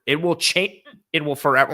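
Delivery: words broken by hiccups; talking through hiccups. Real, not acted. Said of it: "oh my, yeah, hiccups".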